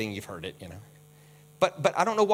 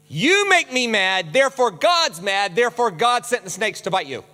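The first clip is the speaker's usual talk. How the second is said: mock angry Moses; 3 semitones higher, 4 dB louder than usual. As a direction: scold the people with mock fury. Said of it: "Happened to Moses".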